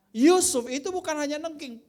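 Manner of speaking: loud, high preacher's declaration; full-force preaching. Acted. Preacher rousing the congregation about Joseph, at full force. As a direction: thunder it from the pulpit.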